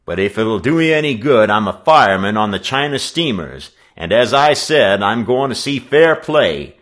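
loud, emphatic workman's orders; forceful, no-nonsense declaration; tart, commanding. A narrator's reading. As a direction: take charge as self-appointed referee. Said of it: "the newcomer retorted tartly".

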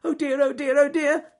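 loud, high fretting squeak; Ernest Fluffy Rabbit. Acted. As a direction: fret in a rapid string of squeals. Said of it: "said Ernest Fluffy Rabbit".

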